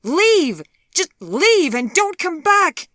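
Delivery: high, loud, furious order to get out. Acted. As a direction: hurl him out of the room. She drives him away.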